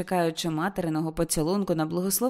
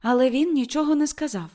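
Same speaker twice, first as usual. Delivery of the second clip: high, loud insistence; insisting; 8 semitones higher, 6 dB louder than usual. Acted. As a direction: Ruth stresses it to her mother. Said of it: "вела далі Рут". (наполегливо, високо й голосно)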